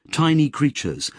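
plain reading voice

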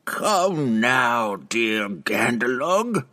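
slow, sly mocking tone; teasing. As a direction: tease him sweetly. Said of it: "Benray teased".

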